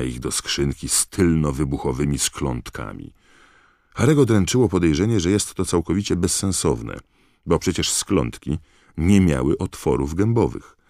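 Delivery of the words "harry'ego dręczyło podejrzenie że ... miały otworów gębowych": ordinary delivery, nothing marked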